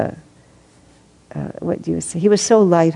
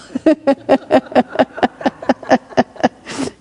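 long high laugh; real laughter; 9 semitones higher than usual. Real, not acted. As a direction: laugh helplessly at the joke. Reader laughing mid-story.

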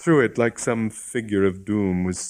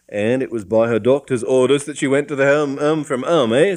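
keen; 6 semitones higher, 7 dB louder than usual. A narrator's reading. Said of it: "said the policeman keenly".

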